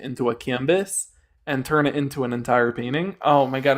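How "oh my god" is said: normal talking voice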